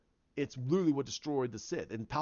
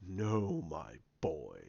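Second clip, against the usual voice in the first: deep, slow, half-whispered villain rasp; Snoke's voice; 11 semitones lower, 6 dB quieter, much slower than usual. Retold or imitated. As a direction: loom over him, low and menacing, breathing the words. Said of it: "He goes".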